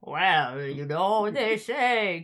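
lightly voiced slow ghost, drawn out; a hint of a spooky informant, stretched slow; ghostly Deep Throat. Podcaster quoting Deep Throat, a light slow ghost voice. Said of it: "he sounds like a ghost".

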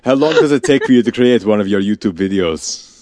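put-on a light Russian accent on the question; Russian accent, half-hearted; Russian-ish accent. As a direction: ask it in a mock Russian accent. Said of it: "more Russian than anything else".

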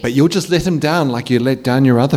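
taunting phantom. Acted. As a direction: twist the knife gently. Nightmare voice taunting.

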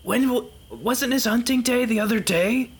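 high-pitched put-on character voice; game character voice. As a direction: ask it in a high, protesting character squawk.

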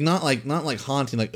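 ordinary talk, nothing marked

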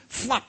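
high, popped sound effect; flying hat sound. Acted. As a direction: pop it out like cloth in wind.

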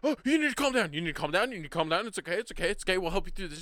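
high, loud, repetitive mock reassurance; frantic calming. Friend talking down a raging kid, frantic mock calming. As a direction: imitate panicked soothing, words tumbling in repeats.

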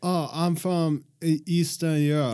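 put-on lightly done vague Eastern European accent; Tommy Wiseau accent. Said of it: "You try it".

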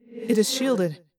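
ordinary delivery, nothing marked

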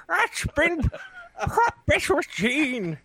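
lightly mimicked gambler drawl, high and slow. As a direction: copy the sly riverboat gambler announce. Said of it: "riverboat music".

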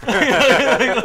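normal talking voice

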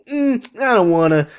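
high, whiny refusal; put-on petulant grumble; mock reluctance. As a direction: whine it like a reluctant child. Acting reluctant man told to go home.

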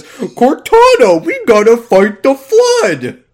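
slow, loud heroic game line; Master Chief. Acted. Podcast host parodying the game hero, slow loud battle call. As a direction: call it out big and drawn-out. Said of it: "As long as Master Chief goes".

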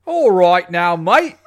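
loud, high Cockney chimney sweep; mock Cockney. Radio host teases Nolan, loud mock Cockney. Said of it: "like a Cockney, you know, chimney sweep".

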